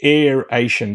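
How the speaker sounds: "Aeration" said as air-AY-shun, falling at the end; three-syllable "Aeration", air-ay-shun; corrected aeration. Shown as a demonstration. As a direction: set "Aeration" down firmly, with no ee after air. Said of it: "That's what it is".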